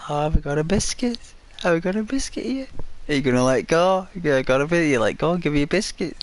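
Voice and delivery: high, fast sing-song coaxing; coaxing pet talk. Acted. Coaxing the dog to drop the toy, high fast pet talk. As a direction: sweet-talk the dog into letting go, quick and high.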